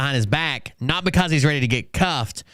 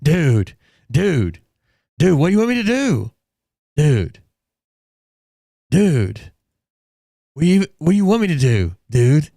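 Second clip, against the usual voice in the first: lightly voiced frat bro, louder; hint of a frat bro, pleading; 3 semitones higher, 3 dB louder than usual. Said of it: "he's like".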